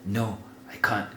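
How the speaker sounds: soft, understated plea; retold crying. Storyteller acting out dreading the toilet, softly pleading. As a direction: quietly imitate a tearful refusal. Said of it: "I'm crying".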